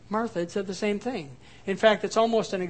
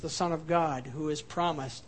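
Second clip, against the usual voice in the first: ordinary delivery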